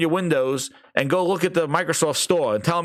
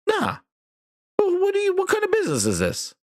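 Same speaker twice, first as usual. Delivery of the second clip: high, disbelieving outburst; can't believe what he's hearing; much higher, stunned disbelief; incredulous. Real, not acted. Incredulous at naive business idea.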